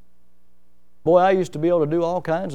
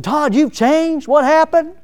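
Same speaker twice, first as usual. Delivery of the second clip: surprised greeting; 9 semitones higher, slower than usual. Retold or imitated. Retold ballpark meeting.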